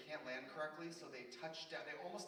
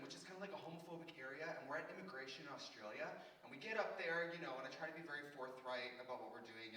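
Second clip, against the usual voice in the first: usual speaking voice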